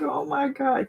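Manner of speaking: high-pitched mock gasp; overwhelmed squeal. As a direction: squeak it out, overcome. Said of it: "I was like".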